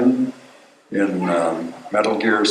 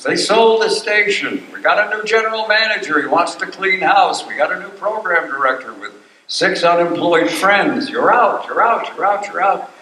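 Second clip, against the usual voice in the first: higher pitch, brisk imitation of station gossip; mock firing announcement; 7 semitones higher than usual. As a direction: lightly voice the bad news being rattled off. Voicing the firing news.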